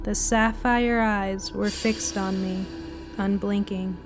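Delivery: ordinary delivery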